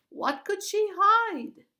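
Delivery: slow, very high scornful scoff; mock the idea. A narrator's reading. Bearded guard scoffs at the other guard, very high and slow.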